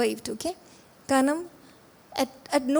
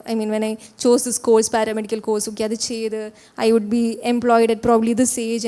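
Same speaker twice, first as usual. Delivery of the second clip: ordinary talk, nothing marked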